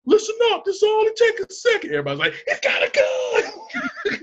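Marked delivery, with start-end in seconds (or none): 0.1-1.9 much higher, a bit louder squeaky demand; mock high voice. Farley addressing the bank crowd in a high squeak. 2.5-4.2 very high, slow, drawn-out panicked cry; mock panic shout. Bank customers panicking, high and drawn out.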